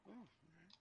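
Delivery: tiny, high, soft mock-meek reply; meek acceptance. Worker hung up on by dispatcher, meekly giving in. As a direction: shrink to a small, deflated voice. Reaction after being hung up on.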